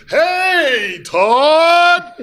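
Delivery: high, hollered, drawn-out Alabama twang; Alabama accent. Retold or imitated. Friend's son cheering him up by phone, loud Alabama holler. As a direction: imitate a kid shouting in an Alabama accent. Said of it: "in that Alabama accent".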